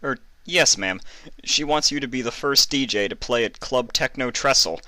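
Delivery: lightly voiced young assistant; calmer assistant. A narrator's reading. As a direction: explain to the boss. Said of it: "a bit calmer now".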